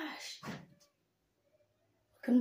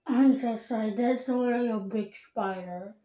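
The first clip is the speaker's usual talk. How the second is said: talking while brushing teeth; muffled around a toothbrush; toothbrush in mouth; 7 dB louder than usual. Real, not acted. Seen 3: Toothbrush held at mouth throughout, clearly in mouth from 2.0s.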